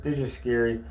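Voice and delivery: usual speaking voice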